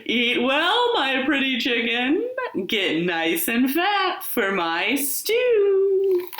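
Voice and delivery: sly wolf calling out to the chicken; wolf crying out. A narrator's reading. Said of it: "what he said".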